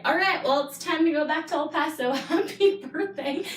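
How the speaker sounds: mock breezy goodbye. Daughter leaving the birthday after sharing a virus, mock cheery. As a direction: toss off a falsely chipper farewell, half laughing.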